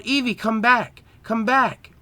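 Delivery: high-pitched call after a runaway pet; yelled call. A narrator's reading. Trainer yelling to Eevee running off to the burning smell, urgent high call. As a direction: call out urgently, pitched well above normal. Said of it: "I yelled".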